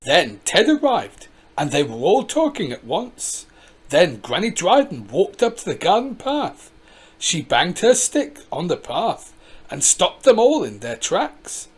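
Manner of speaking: plain reading voice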